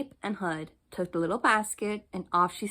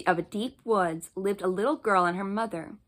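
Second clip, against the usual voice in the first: ordinary delivery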